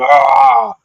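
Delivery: high shouted "Ah!"; reenacted outburst. Streamer retelling the night he snapped on stream, mock raging yell. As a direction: let out a high yell, acting out a past blow-up. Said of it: "came on one night and was like".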